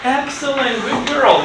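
high, delighted praise for a pupil. Real, not acted. Teacher praises a girl's answer, high and delighted. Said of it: "praising a pupil".

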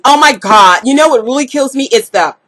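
normal talking voice

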